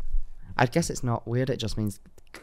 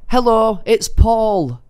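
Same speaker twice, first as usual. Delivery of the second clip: higher, louder caller voice; scammer on phone; 10 semitones higher, 6 dB louder, much slower than usual. Retold or imitated. Scammer phoning his target, higher put-on voice. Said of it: "Was that the accent that you".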